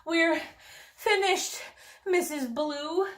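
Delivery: breathless, high; puffing. A narrator's reading. Worn-out princesses reporting to the housekeeper, puffing. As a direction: puff it out exhausted. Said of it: "they puffed".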